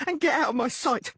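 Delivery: high furious shouting; screaming. Acted. Blonde woman orders Dandelion out of her sight, screaming. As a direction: drive him off with every word.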